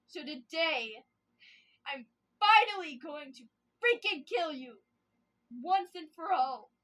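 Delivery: loud, slow, menacing shout; murderous threat. Acted. She screams at Mel before killing him, furious.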